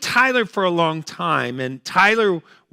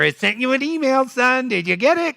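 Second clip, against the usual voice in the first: put-on higher fussy old mother; mom voice; 7 semitones higher than usual. Elderly mother asking about email again, higher voiced. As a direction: nag gently, up in the voice.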